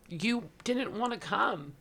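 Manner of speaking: retelling through real tears, pitched high; choked-up storytelling, high and easing down; tearful. Real, not acted. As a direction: keep going while trying not to cry. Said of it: "why am I crying?".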